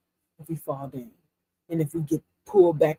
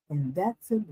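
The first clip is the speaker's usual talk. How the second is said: usual speaking voice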